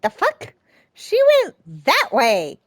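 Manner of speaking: high, loud startled yell; shocked outburst. Real, not acted. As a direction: blurt it out in shock. Player shocked by sudden event.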